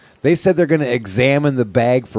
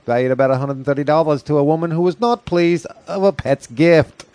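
bright newsreader voice, higher; news read; 8 semitones higher than usual. Acted. Reads a news item.

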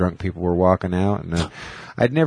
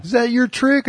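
mock demand; 13 semitones higher than usual. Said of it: "he's like".

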